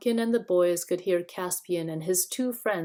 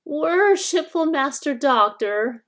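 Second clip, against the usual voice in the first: put-on high whining old hag, louder; thin whining voice; 8 semitones higher, 9 dB louder than usual. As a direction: wheedle like a poor old witch. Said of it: "a thin, whining voice".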